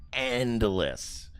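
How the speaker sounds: ordinary delivery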